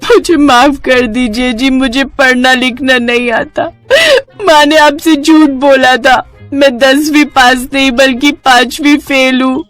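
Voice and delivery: sobbing confession in a high voice; crying hard. Acted. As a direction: beg forgiveness through sobs. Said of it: "प्रीति जोर जोर से रोने लगी". (ज़ोर-ज़ोर से रोते हुए)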